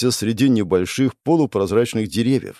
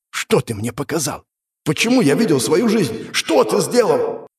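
questions climbing higher, distraught; shaken demand; 6 semitones higher than usual. Acted. Demon to hero about the vision, distraught rising demand. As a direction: demand answers, stretching the last question.

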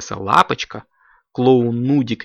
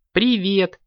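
high, cheery hello; friendly greeting; 10 semitones higher than usual. A narrator's reading. Guests arrive for the shoot, high friendly greeting. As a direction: greet them in a lifted voice. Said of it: "помахали лапками гости".

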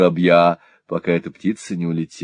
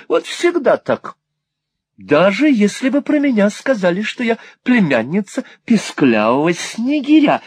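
high-pitched, aggrieved whine; whining; 14 semitones higher, 4 dB louder than usual. A narrator's reading. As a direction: complain high, feeling hard done by. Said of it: "захныкала миссис Никльби".

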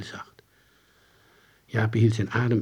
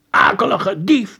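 accusing; 10 semitones higher, 7 dB louder, slower than usual. Acted. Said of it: "begon Jaapie".

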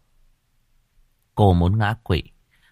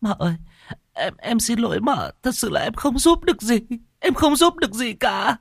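high-voiced, tearful apology; sobbing maid. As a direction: plead and sob at once. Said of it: "khóc nấc".

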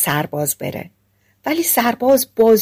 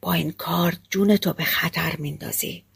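quietly; 8 dB quieter than usual. Acted. Hunter quietly warning the soldier behind the stove. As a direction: hush him with a soft warning. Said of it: "آهسته بهش گفت".

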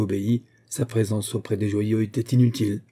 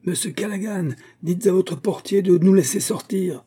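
crying out; 9 semitones higher than usual. A narrator's reading. Trapped guests shout at Callaghan to let them out. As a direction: shout the demand at him. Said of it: "crièrent vingt voix".

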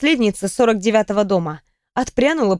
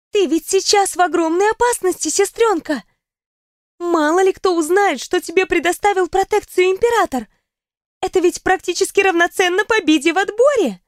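put-on higher, urgent girlish voice; alarmed for her sister; worried young woman; 8 semitones higher than usual. A narrator's reading. Riona worried exclaiming.

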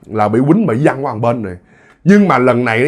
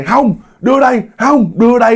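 high, insistent refusal; quarrel refusal; 7 semitones higher than usual. Retold or imitated. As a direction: refuse and grab, pitched high. Two women fighting over child.

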